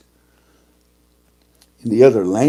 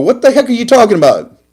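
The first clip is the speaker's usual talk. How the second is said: indignant disbelief; 10 semitones higher, faster than usual. Retold or imitated. Indignant customer.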